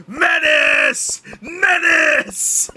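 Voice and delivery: shouting. Real, not acted. Cheering loudly for Menace.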